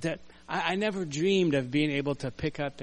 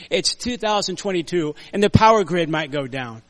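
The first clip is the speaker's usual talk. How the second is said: ordinary delivery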